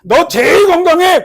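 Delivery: high, loud, slow proclamation; God declaring. Acted. God answering the one who prayed, loud declaration. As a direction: announce it with full authority. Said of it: "하나님이 어떻게 해요?".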